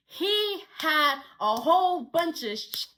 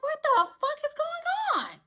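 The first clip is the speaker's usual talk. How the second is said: much higher, confused outburst; bewildered; 12 semitones higher than usual. Retold or imitated. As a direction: blurt it in total confusion. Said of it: "I'm like".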